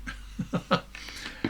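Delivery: quiet airy chuckle; breathy laugh. Real, not acted. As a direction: laugh softly to yourself. Laugh after acted quote.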